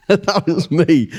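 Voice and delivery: laughing through the words, slow; amused, slow laughing admission. Real, not acted. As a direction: chuckle it out at himself. Laughing at being the dumb one.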